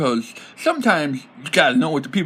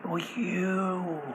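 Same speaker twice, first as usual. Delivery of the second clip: dramatic hush; 5 semitones higher, 9 dB quieter, much slower than usual. Pretend. Dramatic reveal of inspiration.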